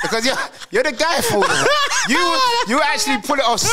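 laughing shout. Real, not acted. Host teasing his friend while laughing, loud and high.

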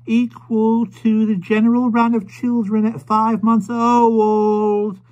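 high, slow and loud, word by word; a proud young mother shouting into an old man's ear; high, slow, proud mother's shriek; shrieking to a deaf man. A narrator's reading. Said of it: "shrieking these short sentences into the old man's ear".

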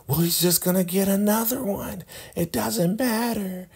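very high falsetto imitation; Joan. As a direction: squeal it in a put-on falsetto. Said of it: "You know how Joan talks".

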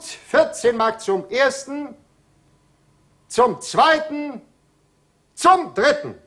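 auctioneer countdown. Acted. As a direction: build to a ringing final call.

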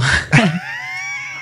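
high burst of laughter; laughing. Real, not acted. Interviewee reacts to the [name] collaboration question, laughing.

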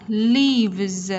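LEAVES ending in a buzzed Z; final Z sound. Shown as a demonstration. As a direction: finish "Leaves" on a clear Z. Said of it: "Z, Z ending sound".

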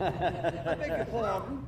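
slow, laughing put-on Boston Brahmin accent; mock Boston Brahmin accent. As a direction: poke fun at the posh Boston Brahmin accent, slowly. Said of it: "sometimes I put 'em on".

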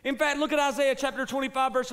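high, loud, slow preaching cry; full-force preaching. Acted. Pastor urging the congregation to trust God, loud slow preaching. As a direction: preach it with fire, slowly.

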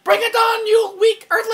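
high, loud alien taunt; conehead voice. Pretend. Alien taunting a weak earthling, conehead voice. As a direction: shout a challenge as a high-pitched conehead. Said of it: "I sound like a conehead".

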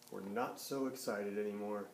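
narrating the cows losing their excitement; deflated. A narrator's reading. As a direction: let the air go out of it.

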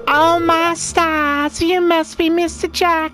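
high, slow, sweet lady villager. Acted. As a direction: draw it out high like a genteel lady. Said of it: "I gotta remember the voices".

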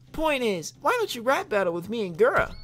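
put-on anime girl voice. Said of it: "try a chick voice".

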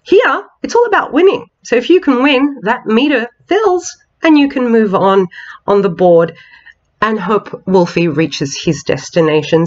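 ordinary delivery

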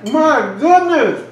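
high, loud, drawn-out amazement; amazed exclamation. Real, not acted. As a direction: marvel out loud, stretching the words. Overwhelmed by how good it tastes.

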